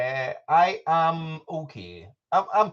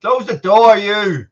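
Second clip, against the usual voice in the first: loud, higher call to close the door; shouting; 8 semitones higher, 12 dB louder, slower than usual. Real, not acted. Dad shouting at his daughter to close the door, loud. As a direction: bark it across the house. Yelling at kid leaving room.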